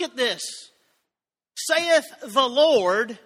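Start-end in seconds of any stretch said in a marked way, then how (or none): 1.6-3.2 slow, louder, drawn out solemn reading; solemn scripture. Acted. Preacher quoting the Lord slowly and solemnly. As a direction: intone it like the voice of God.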